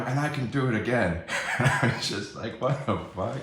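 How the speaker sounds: laughing in disbelief. Real, not acted. Telling viewers about the cop threat, incredulously laughing. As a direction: laugh through the retelling.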